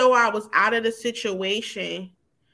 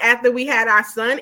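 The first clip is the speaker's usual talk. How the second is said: usual speaking voice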